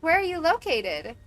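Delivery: high, loud questioning; mock alarm. Agent asks caller where he is, mock alarm.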